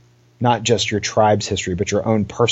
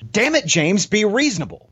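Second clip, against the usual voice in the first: mildly angry, pitched well up; high-pitched exasperated protest; 11 semitones higher than usual. Acted. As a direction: plead crossly for some sense. Overheard heated argument in the study.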